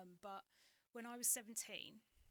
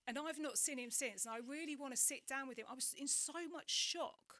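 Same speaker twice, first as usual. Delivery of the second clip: moved; 5 semitones higher, 7 dB louder than usual. Real, not acted. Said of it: "it's making me go funny now".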